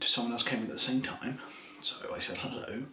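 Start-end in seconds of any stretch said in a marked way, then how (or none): none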